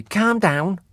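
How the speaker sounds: high, put-on calm down; mimicking Gove. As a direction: lift it high and odd like Gove. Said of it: "and he said it like that too".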